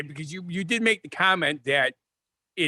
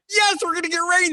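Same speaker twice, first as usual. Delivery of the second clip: excited; 13 semitones higher than usual. Retold or imitated. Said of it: "We're like".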